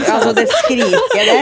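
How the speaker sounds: high, loud laughing words. Real, not acted. Fan reacting with friends to screams, laughing hard.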